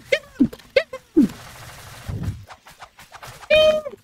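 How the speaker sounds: a high put-on squeak for the chase; squeaky yell. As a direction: squeal it out like a cartoon. Squealed order during chase.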